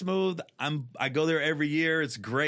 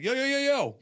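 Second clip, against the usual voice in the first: calming call; 10 semitones higher, 5 dB louder than usual. Retold or imitated. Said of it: "and I'm like".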